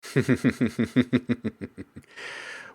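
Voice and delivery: long, steady chuckle; rapid laugh that trails off. Real, not acted. Laughs between remarks.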